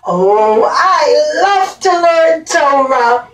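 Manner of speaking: put-on high, bright puppet-king voice greeting the kids; a playful storybook king saying hello; cheerful King Solomon puppet. A puppet Shlomo HaMelech greets the children, high and cheerful.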